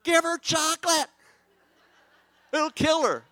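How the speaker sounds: high, loud, slow protest; hammed-up pleading exasperation; mock exasperated. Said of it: "I go".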